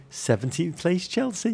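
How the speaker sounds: muttered to oneself with slow relish; savoring mutter. Retold or imitated. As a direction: roll the words round like fine wine. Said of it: "I found myself muttering".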